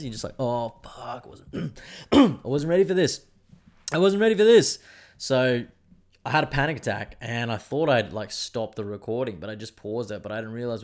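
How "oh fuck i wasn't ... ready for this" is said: high, loud panicked cry. Retold or imitated. Reliving his live-stream panic attack, panicked outburst. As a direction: shout it out in rising panic.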